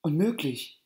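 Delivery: put-on girl bashfully denying to her aunts. A narrator's reading. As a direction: wave it away, embarrassed. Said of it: "entgegnete Periapokak verschämt".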